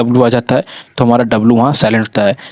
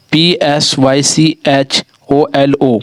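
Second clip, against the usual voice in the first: each letter spelt out slowly and separately; spelling out slowly; much slower than usual. Shown as a demonstration. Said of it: "जैसे कि".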